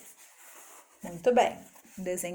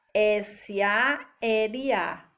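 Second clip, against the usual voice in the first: S A L A named letter by letter, very slow; spelled out slowly; much slower than usual. Shown as a demonstration. Teacher spelling S A L A slowly for the children. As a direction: spell out S A L A one letter at a time. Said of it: "dividida em quatro letras".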